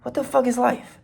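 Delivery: high, incredulous mock question; amused inner disbelief. Driver laughing inwardly at police ordeal, high bemused voice. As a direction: pitch it way up, baffled and amused. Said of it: "kinda laughing in my head".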